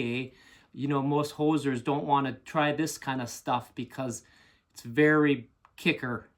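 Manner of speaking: put-on Minnesota-Canadian hoser accent. Hoser greets internet viewers about Canadian beer, mock Canadian accent. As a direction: talk like a folksy Canadian hoser, eh.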